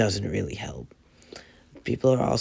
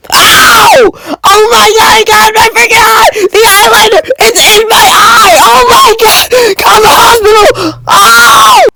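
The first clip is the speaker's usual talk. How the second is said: high screaming in pain; 21 dB louder than usual. Acted. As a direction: shriek like eyeliner hit your eye. Eyeliner poked into his eye.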